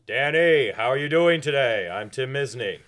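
loud, booming friendly greeting; big salesman-style hello; Tim Misney voice. Retold or imitated. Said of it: "I used to be able to do his voice".

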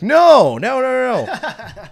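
frantic denial. Retold or imitated. Panicked denial to nurse's question.

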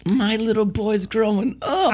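high, slow, gushing coo; drawn-out, syrupy high voice; mock-proud sentiment. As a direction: fake tearful pride in a little boy. Mock-sentimental grandpa about swearing grandson.

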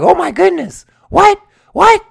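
high, loud and rising "What? What?"; dumbfounded kid. Retold or imitated. Remembering himself as a child, stunned high exclamation.

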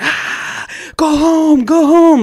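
loud, high-pitched mock yelling; retold crazy yelling. Cops taunt a painter on the street, wild retold yelling. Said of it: "straight yelling at me".

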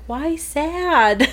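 high-pitched imitation of a child; puzzled daughter. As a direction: ask it like a little kid. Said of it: "She's like".

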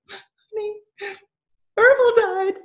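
high, tearful wail through sobs; crying. Retold or imitated. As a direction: sob it out, voice breaking high. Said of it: "tears are streaming down my face".

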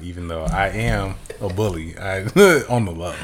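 ordinary talk, nothing marked

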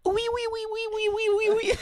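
imitated very high, rapid squeals; baby-like squeal. Friend imitating a French girl's cries, baby-like squeal. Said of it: "It sounded like a baby".

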